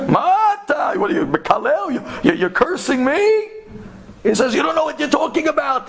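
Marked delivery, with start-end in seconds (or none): 0.1-3.5 high, stammering indignation; upset, offended. Retold or imitated. Taxi driver thinks the scholar cursed him, upset. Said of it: "the Nahag got upset". 4.5-5.9 high-pitched angry dismissal; retold cabbie temper, pitch raised; angry retort. Taxi driver dismisses the scholar's words, angry. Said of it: "The taxi driver got angry".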